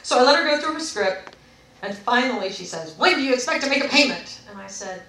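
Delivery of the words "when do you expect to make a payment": loud, rapid-fire demand; demanding imitation. Rep pressing customer after script, demanding. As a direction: press hard for an answer as the rep.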